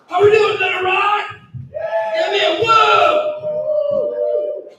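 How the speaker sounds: loud, high stage greeting; crowd hype shout. Real, not acted. Headliner greeting Little Rock crowd, high hype shout. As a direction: shout to the whole room, stretching the last word.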